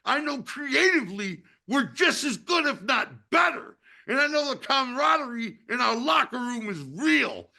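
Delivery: fired-up, proud conviction; passionate. Real, not acted. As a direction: build your case with fervor. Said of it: "hear that passion in your voice".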